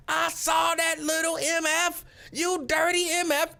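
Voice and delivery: high, slow, furious retold screaming; high, drawn-out mock scream at the man; mock screaming woman. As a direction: yell it like the outraged woman chasing him. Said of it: "she was screaming".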